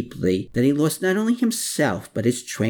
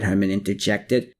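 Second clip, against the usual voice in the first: ordinary delivery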